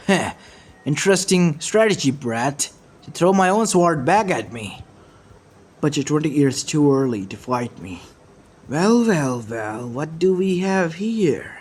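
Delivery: mocking battle banter from a villain; taunting villain.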